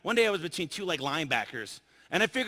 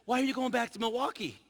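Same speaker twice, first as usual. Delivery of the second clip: slightly put-on higher voice, curious; a hint of the inquisitive attendant; lightly voiced flight attendant; 6 semitones higher than usual. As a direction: imitate her question in a raised pitch. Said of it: "She says".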